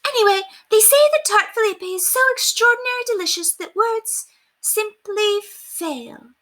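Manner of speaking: high gushing, slowing to a dreamy stop; rave, then trail off; excited lady then fading as she reads; excited babble. Acted. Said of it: "resumed her excited babble".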